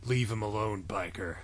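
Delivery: quiet, low threat from the shadows; low stranger voice. Acted. Mysterious voice, low soft.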